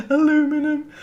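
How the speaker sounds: high, giggly "Aluminum", stressed on "lu"; amused American pronunciation. Real, not acted. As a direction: squeak out Aluminum through laughter. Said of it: "I find it so funny".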